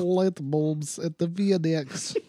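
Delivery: slow Light bulbs at the FedEx, Fee-deks; hammed-up Gru voice; slow Russian-accent line; mock Russian accent. Shown as a demonstration. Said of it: "I was waiting for you to be like".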